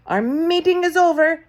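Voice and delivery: high, loud witch's announcement; witch announcing. A narrator's reading. Grand High Witch announces to her audience, high and loud. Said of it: "announced the Grand High Witch".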